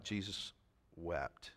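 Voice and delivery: very soft and low, pausing before the last word; hushed solemnity. Real, not acted. Preacher answers what verse thirty-five says, hushed and solemn. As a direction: drop right down and let it land.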